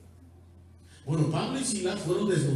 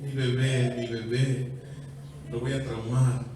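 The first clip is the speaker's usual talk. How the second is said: lightly voiced mother fussing over her baby; a hint of a worried mom, half teasing; mock fretting mother; 2 semitones lower than usual. Preacher mimics a mother who spanks and then frets. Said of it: "como muchas mujeres, mamás, lo hacen".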